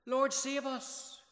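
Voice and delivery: high, loud, slow desperate plea; panicked disciples begging Jesus to save them; terrified cry for help. Acted. Disciples crying to sleeping Jesus, terrified plea. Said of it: "and they went".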